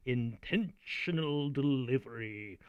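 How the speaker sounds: slow, chewed-over words; drawn-out, deliberate teacher delivery. Shown as a demonstration. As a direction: savor each word slowly. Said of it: "by conveying my meaning through that intentional".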